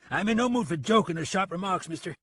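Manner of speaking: irate, high-pitched warning; angry. Acted. Insulted deputy to the joking gunman, angry. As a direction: push back angrily. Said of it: "Price said angrily".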